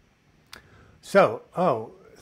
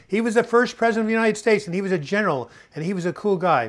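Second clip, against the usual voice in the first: higher and louder, building pride; proud enthusiasm; 7 semitones higher, 7 dB louder than usual. Real, not acted. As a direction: sell him like a hero. Enthusiastic about George Washington.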